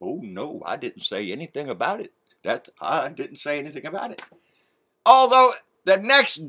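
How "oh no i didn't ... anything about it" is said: softer, lower mock-innocent denial; mocking Junior. Vlogger mocks Junior dodging the committee's question, small and soft. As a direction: play the meek little liar. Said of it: "oh, Junior there".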